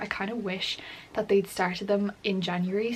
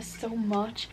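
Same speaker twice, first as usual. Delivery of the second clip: ordinary delivery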